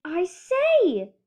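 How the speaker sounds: surprised elephant; a touch of astonished elephant; Horton exclaiming. Acted. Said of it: "muttered Horton".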